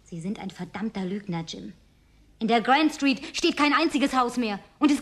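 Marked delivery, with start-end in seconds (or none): 0.0-1.7 low, soft and cold; quiet contempt, each word pressed; hushed, hurt fury; bitter accusation. Acted. Accusing Jim of lying.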